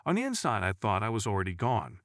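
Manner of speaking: ordinary delivery, nothing marked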